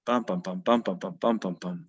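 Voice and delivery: bum bum bum as a steady galloping beat, stress on each bum; chanted rhythm sounds showing a galloping beat. Shown as a demonstration. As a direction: tap out the anapaest gallop on bum. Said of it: "you suddenly get".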